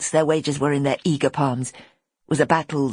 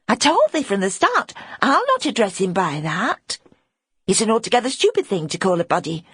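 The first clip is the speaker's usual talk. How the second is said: Pip, protective; 6 semitones higher than usual. Acted. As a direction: stand up for the little one. Pip defending the boy.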